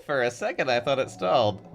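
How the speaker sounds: ordinary delivery, nothing marked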